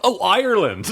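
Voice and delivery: loud, high burst, "Ireland" fully sounded as "Eye-er-land"; imitated sudden realisation. Coworker finally getting it, mock loud realisation. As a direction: act out the penny suddenly dropping. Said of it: "And then a second later, he's like".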